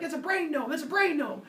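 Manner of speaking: high-pitched comic panic; mock screaming. Zeus screaming at the tiny person, mock high panic. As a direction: imitate screaming without full volume. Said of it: "Zeus starts screaming".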